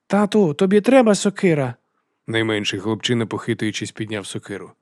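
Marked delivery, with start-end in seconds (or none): 0.1-1.7 put-on very high, loud child's voice; high-pitched childish question; eager little boy. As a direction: offer it eagerly as a small son. Youngest son eagerly offers axe.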